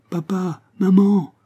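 put-on high, insistent child's call; high little voice rising to a loud cry. A narrator's reading. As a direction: call like a boy getting closer. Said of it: "continua la voix en se rapprochant toujours".

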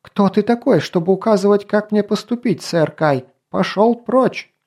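high, piercing, indignant princess voice; a high-pitched princess cutting through the shouting; shrill princess. A narrator's reading. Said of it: "тонко прорезал этот крик голос принцессы".

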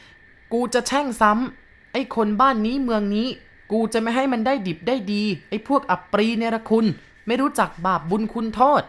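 possessed cursing voice. A narrator's reading. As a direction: curse them as the ghost inside the boy. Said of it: "เสียงของเด็กเปลี่ยนไป".

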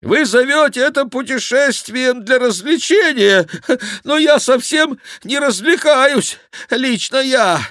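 high, loud protest in jolted bursts; complaining monk. A narrator's reading. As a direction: complain loudly in a high, breathless voice. (громкая высокая жалоба монаха)

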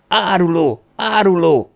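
high-pitched cry of accusation; outraged cry. A narrator's reading. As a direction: cry it out as you lunge at a betrayer. Ridzett lunges at the betrayer.

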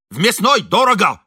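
angry retort. A narrator's reading. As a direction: shout back in a row. Шаринов огрызается в ссоре. (сердитый крикливый ответ)

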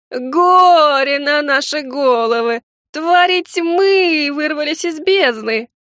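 slow, high, loud lament; drawn-out wail of doom; wailing gargoyle. Acted. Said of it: "продолжала голосить статуя".

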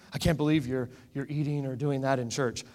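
mildly scandalised, lightly imitating a stuffy believer; stuffy disapproval. Spiritual people objecting to eating in church, mock-stuffy disapproval. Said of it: "get a little stuffy".

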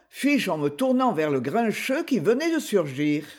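plain reading voice

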